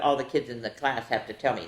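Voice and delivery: ordinary delivery, nothing marked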